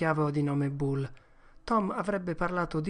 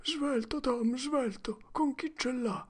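put-on much higher, urgent boy voice; panicked boy; 10 semitones higher than usual. Huck begs Tom in panic, very high. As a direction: rush it out in panic.

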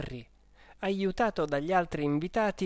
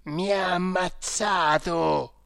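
scream; 4 dB louder, slower than usual. A narrator's reading. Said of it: "si udì un urlo".

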